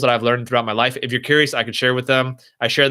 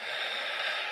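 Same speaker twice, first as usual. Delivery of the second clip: long, soft demonstrated exhale; deep breath; 15 dB quieter than usual. Shown as a demonstration. Streamer showing a viewer how to breathe deeper, long exhale. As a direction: breathe out slowly and audibly. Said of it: "take deeper breaths".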